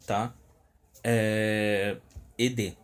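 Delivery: normal talking voice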